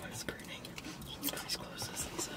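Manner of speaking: breathless whisper through the burn; pained whisper. Real, not acted. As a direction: whisper in spicy pain. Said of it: "My nose is burning".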